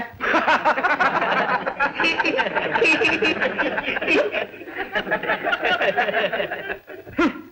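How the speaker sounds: high, prolonged cackling laughter; long mocking laugh. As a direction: laugh on and on in ridicule. Mocks the failed thief hunt. (देर तक खिल्ली उड़ाती हँसी)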